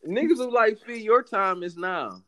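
high, loud rallying cry; booming motivational speech. Acted.

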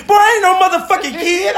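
very high mock indignation; imitating angry partner. Retelling partner's reply after the scare, high mock anger. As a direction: squeal the scolding in exaggerated outrage. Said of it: "She was mad as fuck".

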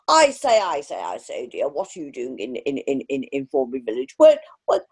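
imitating a pompous posh English gent; posh English accent. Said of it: "can't even do a posh accent".

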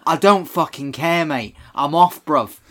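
a Cockney villain snapping that he's done; cockney geezer accent. Retold or imitated. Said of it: "what happened to your accent?".

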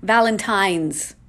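Valentine's drawn out slowly with a clear N. Shown as a demonstration. Vlogger insists on the N in Valentine's, slow and firm. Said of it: "spelled with an N, not an M".

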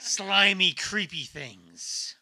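loud, very slow creepy drawl; spooky voice. Pretend.

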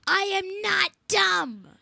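slow, loud, defiant boast; indignant protest. Real, not acted. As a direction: proclaim it so everyone hears. Kid protests he's not dumb.

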